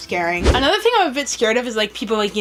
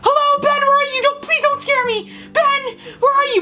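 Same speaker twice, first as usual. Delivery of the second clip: high, panicky calling out; acted nervous cry, pitched way up; retold freak-out. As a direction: plead anxiously into the empty house. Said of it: "freaking out and going".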